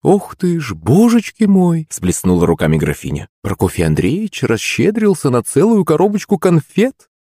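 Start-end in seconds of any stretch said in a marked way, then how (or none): 0.0-1.9 high, drawn-out theatrical gasping wonder; mock amazement. A narrator's reading. Countess astonished at her husband's offer, mock amazement. 3.4-7.1 high, gushing mock disbelief; sarcastic amazement. A narrator's reading. Countess teasing husband over box of sweets, sarcastically amazed.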